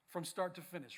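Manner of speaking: ordinary talk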